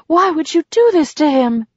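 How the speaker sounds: high, distressed demand. A narrator's reading. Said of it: "she demanded".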